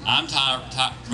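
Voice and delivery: ordinary delivery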